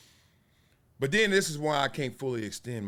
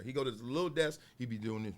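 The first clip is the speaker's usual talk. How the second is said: usual speaking voice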